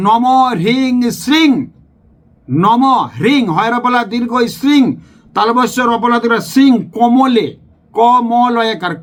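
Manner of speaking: শ্রিং with a long rising ি, slowly spelt out; কমলে কমলালয়ে stretched syllable by syllable; careful pronunciation. Shown as a demonstration. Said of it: "বারবার বলছি".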